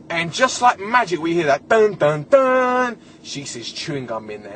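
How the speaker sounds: usual speaking voice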